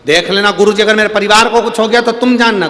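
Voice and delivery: threat. Acted. Disciple threatens the guru.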